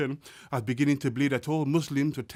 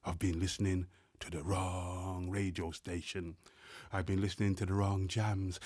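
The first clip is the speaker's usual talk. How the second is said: hushed refrain; 9 semitones lower, 11 dB quieter than usual. Acted. Repeated refrain, hushed this time.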